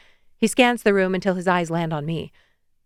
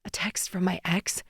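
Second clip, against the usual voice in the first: whispered, much softer confession; broken whisper; 8 dB quieter than usual. Acted. Macy telling Noah the sad news, whispered. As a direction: barely breathe out the bad news.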